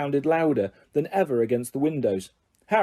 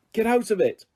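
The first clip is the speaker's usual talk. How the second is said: a sharp, higher angry cry; angry shout; 8 semitones higher than usual. A narrator's reading. Ron yells at Crookshanks, angry and higher. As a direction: shove the cat off with the words. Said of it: "shoved Crookshanks angrily away".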